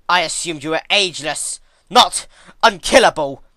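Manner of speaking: loud, heated retort; arguing back at the goddess, loud; argued back. Acted. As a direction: snap back hard, rising on unkillable. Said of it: "Twilight argued back".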